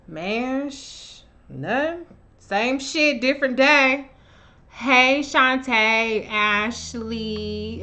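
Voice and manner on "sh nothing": ordinary delivery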